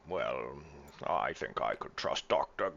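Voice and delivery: put-on slurred, halting drunken captain; Greybeard, drunken. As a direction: weigh it up like a tipsy old captain. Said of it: "do my best drunken Greybeard voice".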